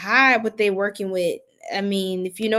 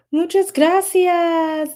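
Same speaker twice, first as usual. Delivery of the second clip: put-on Spanish accent; 7 semitones higher, 5 dB louder, slower than usual. Mock Spanish thanks, spirit voice.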